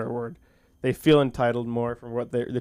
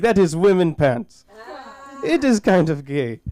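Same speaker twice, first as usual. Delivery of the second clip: put-on light Indian accent; 5 semitones higher than usual. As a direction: imitate an Indian roommate's teasing, lightly accented. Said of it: "My Indian roommate".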